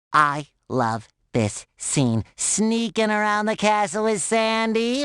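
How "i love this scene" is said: low, slow, separated words; savouring each word. Acted. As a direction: dwell on every word with relish. Savours a favourite scene.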